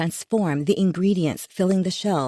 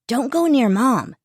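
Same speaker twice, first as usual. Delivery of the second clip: warning; 6 semitones higher, 5 dB louder than usual. Acted. Son warning sister.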